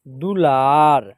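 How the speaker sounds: दुलार slowly, short दु, long ला; drawn-out model reading. Shown as a demonstration. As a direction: hold the आ in दुलार. Teacher models the blended word.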